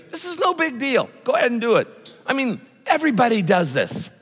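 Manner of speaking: high, coaxing tempter voice; Satan tempting. Acted. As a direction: purr the temptation like a sly devil. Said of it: "He goes".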